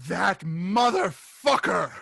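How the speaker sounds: hammed-up outraged yell; angry yell.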